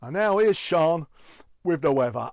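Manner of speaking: loud mock handover; punchy hand-off to the weather; announcer as newsreader. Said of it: "he started out as a newsreader".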